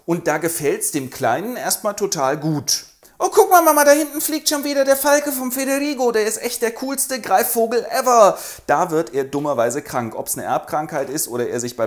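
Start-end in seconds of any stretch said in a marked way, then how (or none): 3.2-8.4 put-on high, loud excited little boy; child voice. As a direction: play an excited small kid.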